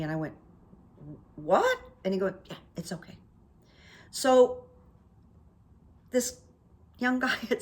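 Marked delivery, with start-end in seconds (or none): 1.4-1.8 surprised what. Retold or imitated. Surprised at free candy. 2.5-3.2 hushed clerk. Retold or imitated. Clerk voiced quietly, unvoiced.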